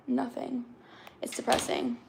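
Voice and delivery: ordinary talk, nothing marked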